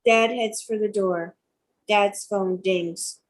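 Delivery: ordinary talk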